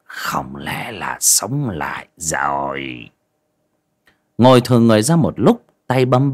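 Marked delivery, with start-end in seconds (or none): 0.0-3.1 slow, low, whispery suspicion; hushed, drawn-out disbelief; doubtful musing. A narrator's reading. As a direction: wonder slowly under your breath. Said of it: "nghi hoặc".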